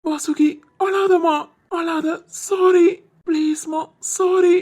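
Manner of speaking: slow, drawn-out pleading; pleading apology. Acted. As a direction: beg her not to cry, stretching each word loudly. Husband begs sobbing wife's forgiveness.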